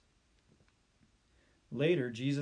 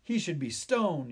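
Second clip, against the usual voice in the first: raised, higher angry call; angry yell; 4 semitones higher, 6 dB louder than usual. A narrator's reading. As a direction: yell it from the crowd. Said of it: "yelled another".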